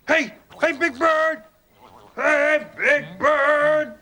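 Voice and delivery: high calling out to wake someone. Real, not acted. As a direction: call him awake. Waking sleeping Big Bird.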